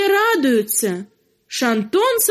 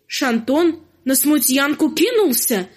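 plain reading voice

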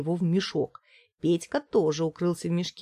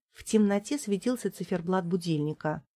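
ordinary delivery

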